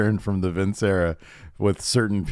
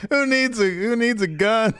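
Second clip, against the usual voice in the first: much higher, laughing, slowed on gun; 13 semitones higher than usual. Real, not acted. Host joking that Marines need no gun, laughing. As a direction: crack up mid-joke.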